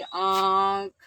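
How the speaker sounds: आँख drawn out loud, the vowel through nose and mouth; nasalized vowel. Shown as a demonstration. Teacher demonstrating anunasik sound to students, long nasalized आँख. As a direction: hold a long nasalized आँ in आँख, then close on ख. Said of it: "नाक और मुख दोनों के दबाव से".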